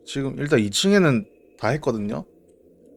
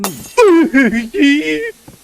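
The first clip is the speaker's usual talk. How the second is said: high startled laugh; 13 semitones higher, 9 dB louder than usual. Real, not acted.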